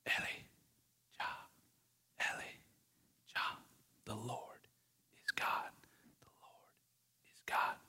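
very soft, slow whisper with long gaps; gentle whisper. Acted. God answers Elijah on the mountain, gentle whisper. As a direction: whisper it tenderly, fading to almost nothing. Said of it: "if the gentle whisper just said".

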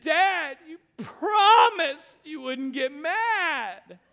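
high, loud, slow sobbing words; crying child's plea. Retold or imitated. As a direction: sob it out to dad. Son crying in confession.

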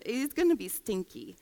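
soft, grossed-out ew; lightly voiced disgust; mock disgust. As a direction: wrinkle your nose at the smell. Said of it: "a little bit of disgust".

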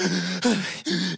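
deep crying gasps, mimicked; hitching mock-cry breaths; imitated sobbing gasps. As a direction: mimic a child too upset to speak. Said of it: "doing that, that deep cry thing".

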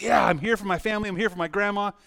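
eager, reassuring 'I'm here for you'; super strong. Retold or imitated. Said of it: "I remember being super strong".

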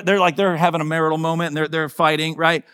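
ordinary talk, nothing marked

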